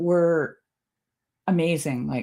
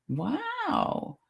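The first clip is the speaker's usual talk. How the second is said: mock parrot, wow jumps much higher and soft; 10 semitones higher, 9 dB quieter than usual. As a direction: squeak a high, soft, amazed wow like a parrot. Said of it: "he said it in a different tone".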